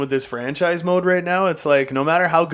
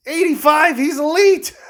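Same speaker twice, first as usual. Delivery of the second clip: sarcastic praise; 13 semitones higher, 4 dB louder than usual. Real, not acted. Mocking bad goalie rating.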